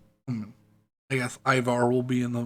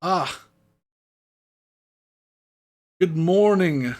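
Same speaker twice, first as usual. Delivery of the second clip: formal herald; 3 semitones higher, 6 dB louder than usual. Acted. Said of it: "He goes".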